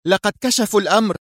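high, quick nervous outburst; nervous anger. A narrator's reading. Sonia reports to the organisation's boss, angry and nervous. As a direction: blurt it out in agitated anger. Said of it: "في غضب عصبي".

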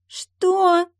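put-on very high, loud girlish cry of disbelief; spoiled noblewoman's high yelp; shriek. A narrator's reading. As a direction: cry out in scandalised shock. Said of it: "вскрикнула маркиза".

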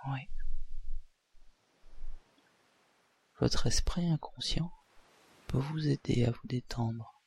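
soft, calm hypnotist induction; hypnosis voice. Acted. Leads a hypnosis session.

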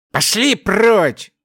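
slow barked command; growling. A narrator's reading. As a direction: snarl it at the beasts. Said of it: "рыкнула она на дарганов".

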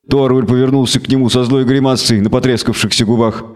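ordinary delivery

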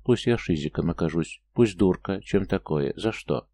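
ordinary delivery

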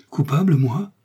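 high, loud protesting question; rising disbelief at the accusation; incredulous. Acted. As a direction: act the wronged innocent. Milady feigns wounded innocence.

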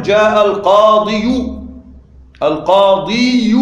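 القاضي drawn out with a sounded -u ending, al-qāḍiyu; pronounced damma. Shown as a demonstration. Teacher showing a heavy ending to students, القاضي said slowly as al-qāḍiyu. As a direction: labour the added "yu" at the end of القاضي. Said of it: "لو أردنا أن ننطق الضمة".

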